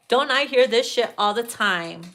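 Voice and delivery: usual speaking voice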